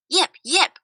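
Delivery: high yipping coyote bark; coyote yip. A narrator's reading. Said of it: "Coyote barked".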